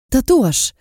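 high, sudden exclamation; cry of discovery. A narrator's reading. Heroine finding proof of who she is, cry of discovery. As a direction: blurt it out as a sudden revelation. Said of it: "krzyknęłam odkrywczo".

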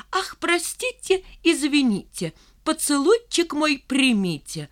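тонким голоском, медленно и потише; притворно извиняйся, жеманно нараспев; издевательское кривлянье. Acted. Валька издевательски дразнит стишком. (кривляется тоненьким голоском)

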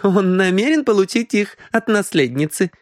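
high, mock-amused woman's voice; giggly. A narrator's reading. Мисс Киан taunts Хэй about the heiress, giggly. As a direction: pitch it high with a hint of giggle. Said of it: "хихикнула Киан".

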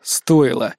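low, dry one-word answer; dry reply. A narrator's reading. Hero to the doubting hunter, dry. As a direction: answer drily, pitch dropped. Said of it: "сухо ответил я".

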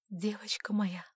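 tearful mother's whisper; trembling whisper. A narrator's reading. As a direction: whisper it through tears. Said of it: "дрожащим голосом прошептала мама".

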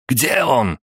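high-pitched shouted question; urgent shout. A narrator's reading. Jess searching for the robot with pistols, shouting. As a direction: cry out, hunting for the enemy. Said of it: "крикнул Джесс".

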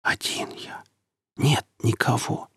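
weak, effortful whisper; strained whisper. A narrator's reading. Beaten captive answering the bandit, strained whisper. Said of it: "Марек с усилием прошептал".

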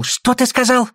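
high, indignant retort; touch of hot temper, pitched high; flaring up. A narrator's reading. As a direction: bristle and take up the challenge. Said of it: "Васька вспылил".

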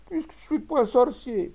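high, loud crying protest; tearful wail. A narrator's reading. Exhausted Rabalan protesting to his attacker, weeping wail. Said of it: "pleurait-il".

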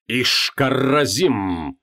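slow, drawn-out ritual cry; shouted incantation. Acted. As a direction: call the name out slowly like a spell. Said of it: "выкрикивал он по дороге".

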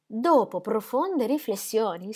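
più lenta e più calma; ripresa lenta. Acted. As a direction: riprende con cura le parole. Repeats slower, steadier. (dopo profonde riflessioni ripetuto lento e misurato)

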